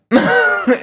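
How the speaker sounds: a sudden high, breathless laugh; high breathy laugh. Real, not acted. Coach laughing at his own rough method, high breathy laugh. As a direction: burst out laughing, no voice.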